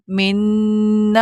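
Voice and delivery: "من" with the doubled نون held long in the nose; held nasal ghunna. Shown as a demonstration. Teacher demonstrating doubled noon for students, nasal hum. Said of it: "مشدد ہے نون".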